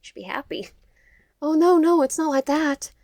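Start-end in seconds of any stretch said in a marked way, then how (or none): 1.4-2.9 high, protesting, guilty-sounding; guilty denial. A narrator's reading. Denying any history with Nicholas, guilty. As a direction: deny it too quickly, pitched up. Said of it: "Well, that sounds guilty".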